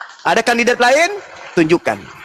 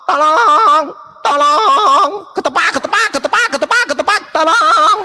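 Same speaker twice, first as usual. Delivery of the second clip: mocking cry for help; 12 semitones higher than usual. Mocking opponents as a thief screaming for help. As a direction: squeal for help like a tied-up chicken thief. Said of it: "kalian hanya bisa teriak".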